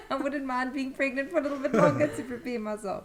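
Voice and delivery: high, loud laughing confession. Real, not acted. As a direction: laugh through the joke. Joking about staying pregnant.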